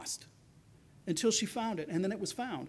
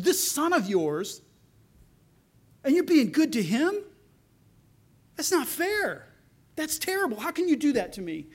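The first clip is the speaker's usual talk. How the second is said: much higher, loud aggrieved whine; whining protest; 6 semitones higher, 4 dB louder than usual. Retold or imitated. Older brother whining to father that it's unfair. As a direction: sound hurt and petulant.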